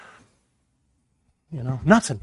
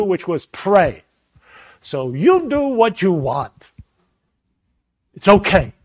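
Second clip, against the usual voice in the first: testy with the Lord; 5 semitones higher, 6 dB louder than usual. Retold or imitated. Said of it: "I got a little testy with the Lord".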